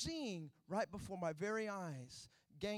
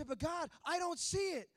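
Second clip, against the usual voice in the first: whiny plea; 11 semitones higher, 8 dB louder than usual. Retold or imitated. Believer whines to God.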